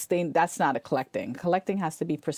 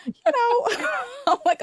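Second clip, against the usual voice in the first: high, loud laughing through the words; laughing hard; 4 dB louder than usual. Real, not acted. Retelling the podcaster story, laughing hard. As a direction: laugh it out, near tears.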